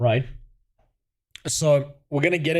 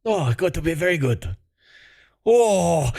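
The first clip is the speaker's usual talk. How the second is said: mock Chinese accent; 4 semitones higher than usual. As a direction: slip back into the put-on Chinese accent.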